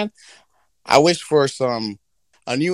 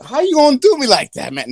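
hammed-up whiny genie; indignant genie; 8 semitones higher, 4 dB louder than usual. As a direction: protest being pushed into the human world. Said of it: "He gonna be like".

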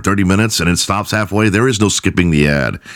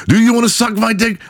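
much higher, jeering defendant voice; defiant defendant. Retold or imitated. Retelling the defendant taunting the judge, high jeer. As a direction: taunt the judge like a cocky prisoner.

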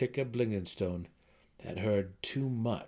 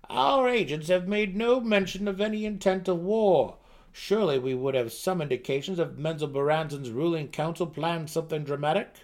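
higher, louder anxious counselor voice; an uneasy advisor pressing the king; worried gnome counselor; 7 semitones higher, 6 dB louder than usual. Acted. Counselor voices fear of war.

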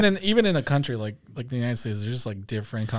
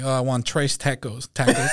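Spanish "tres tacos" in a flat American accent, "trace tack-ohs"; gringo Spanglish; 2 semitones higher than usual. Shown as a demonstration. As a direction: mangle the Spanish with American vowels. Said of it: "when I speak Spanglish".

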